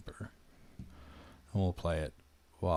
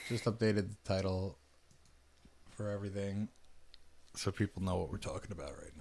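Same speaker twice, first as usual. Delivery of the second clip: ordinary delivery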